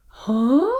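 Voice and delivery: short, astonished huh; startled huh. Shown as a demonstration. Storyteller leading children in the prince's reaction, startled huh. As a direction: echo the prince's baffled reaction. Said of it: "Can you do that?".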